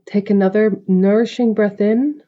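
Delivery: calm, spacious meditation delivery; guided meditation. Acted. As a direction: guide the listener gently into stillness, stretching the breath cues. Leads a meditation.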